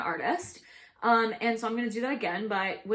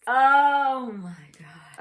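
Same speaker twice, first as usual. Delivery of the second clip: drawn-out gasp; 9 dB louder, much slower than usual. Real, not acted. Reacting to a lyric.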